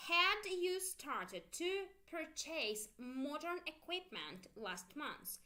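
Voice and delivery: slow, rising "had you started to purchase modern equipment"; slow model question. Shown as a demonstration. Teacher drilling the past perfect question slowly. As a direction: stretch the question out for copying. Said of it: "listen carefully".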